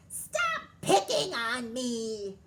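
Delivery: loud, slow furious shout; roaring tantrum. A narrator's reading. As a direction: roar it like a spoiled queen. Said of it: "Queen Bee roared".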